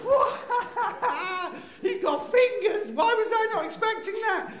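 very high, loud squealing freak-out; hammed-up new-father meltdown; hysterical panic. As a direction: shriek it all in mock terror. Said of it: "my emotional state switched".

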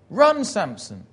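high, loud order falling away; shouted command. Retold or imitated. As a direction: yell an order across the arena. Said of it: "somebody shouts".

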